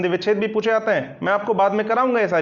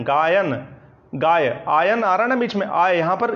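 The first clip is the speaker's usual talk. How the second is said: usual speaking voice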